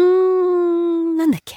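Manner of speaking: a hint of a forgetful old mother thinking hard; mother trying to recall. Forgetful mother searches for the vegetable's name, slow and high. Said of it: "って忘れてんですよ".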